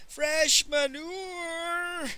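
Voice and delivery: high, long-drawn mournful street cry; quavering melancholy shout. A narrator's reading. Old peasant hawking from his cart, quavering and melancholy. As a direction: call it out high and sorrowfully, stretching the vowel. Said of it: "in a quavering, melancholy voice".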